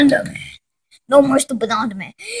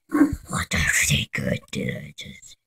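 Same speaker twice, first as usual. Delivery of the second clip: whisper; 14 dB quieter than usual. Acted. Whispered aside.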